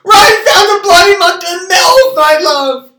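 high shrieking scream. Real, not acted. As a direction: scream it, beside yourself. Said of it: "Why are you screaming at me?".

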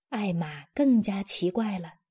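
usual speaking voice

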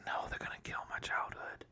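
hushed, whispered inner dismay; emotional whisper. Retold or imitated. Fan recalling fear for a childhood hero, whispered. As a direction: whisper it like a dreaded thought in the theater. Retelling own emotional cinema reaction.

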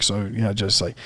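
soft, low-voiced mumble of the friend; low murmur. Friend giving instructions in a low murmur. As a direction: murmur it under your breath.

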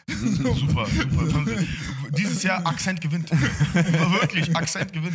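loud, laughing exclamations; amused laughing. Real, not acted. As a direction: crack up while cheering on the accent slip. Teasing friend's accidental French accent.

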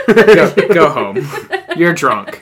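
loud, laughing, slow go home; laughing tease. Real, not acted. Friend laughing at a pun, loud. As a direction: laugh the joke off. Teasing after a bad pun.

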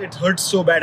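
usual speaking voice

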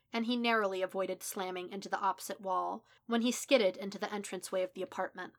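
plain reading voice

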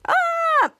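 very high imitated shriek; sustained high mock scream; frightened shriek. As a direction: squeal as if scared by the TV. Jasper scared again by the show.

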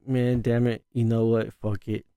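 sighing surrender; quiet resigned muttering. Retold or imitated. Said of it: "But I was like".

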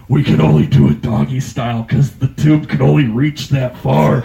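loud, breathy masked voice; Darth Vader mask voice. Retold or imitated. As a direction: speak through a breathing mask.

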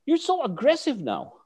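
high-pitched retold reproach; exasperated scolding. Guru Jun confronting his student, high exasperated reproach.

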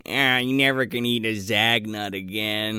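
low, put-on deathbed voice; hammed-up last words, pitched low; mock dying man. Continues the shot man's last words.

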